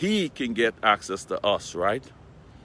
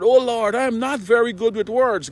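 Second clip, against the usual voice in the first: pleading Moses; 9 semitones higher, 5 dB louder than usual. Retold or imitated. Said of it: "Moses pleaded with the Lord".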